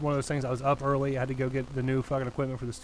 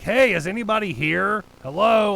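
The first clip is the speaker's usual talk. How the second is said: raised, higher retold yell at an apartment block; yelling at the building; 6 semitones higher, 7 dB louder, slower than usual. Outside a locked building, he yells for anyone, retold. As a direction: call out to anyone inside, slower. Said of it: "I start yelling at the building".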